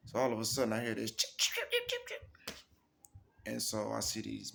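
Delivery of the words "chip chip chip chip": imitated chirping. Imitating a creature's sound.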